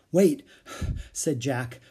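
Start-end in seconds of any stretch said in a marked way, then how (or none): 0.1-0.4 winded, breath after the word; panting. A narrator's reading. Jack stops after fleeing the jaguar, panting. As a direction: gasp it out after running.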